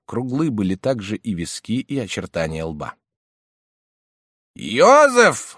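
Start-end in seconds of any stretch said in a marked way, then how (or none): 4.5-5.5 high, loud, slow call. A narrator's reading. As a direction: call him from across the room. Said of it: "вновь позвал его дядя".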